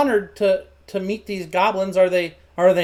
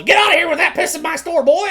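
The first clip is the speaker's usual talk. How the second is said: high, loud, fast scolding yell; angry dad yelling; 9 semitones higher, 5 dB louder, faster than usual. Acted. Dad yells at boy over mess in his store, angry. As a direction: holler at your son to clear out. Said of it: "his dad, like, yells".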